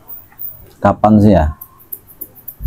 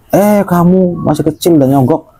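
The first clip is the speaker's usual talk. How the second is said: higher, indignant cop scolding; scolding policeman; 7 semitones higher than usual. Acted. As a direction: catch the kid bribing, sound outraged. Policeman scolds bribing kid.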